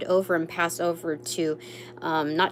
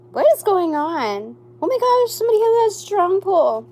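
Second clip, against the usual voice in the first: much higher and louder, a rush of astonishment; excited shock; 11 semitones higher, 7 dB louder than usual. Real, not acted. Reader reacts with shock to drawing the Devil card, high and loud. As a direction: squeal it as the card lands.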